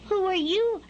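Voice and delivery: high, questioning chick voice; baby bird. A narrator's reading. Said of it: "the baby bird asked".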